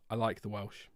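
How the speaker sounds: soft, low reassurance; quiet insistence. Real, not acted. Softly insists after denying being anti-Welsh.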